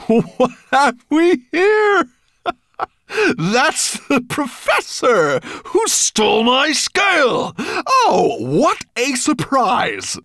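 very high, slow, laughing villain; gloating dragon. A narrator's reading. Dragon recognising the professor who robbed him, gloating. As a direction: savour the discovery, drawing out every word.

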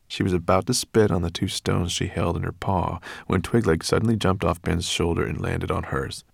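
ordinary delivery, nothing marked

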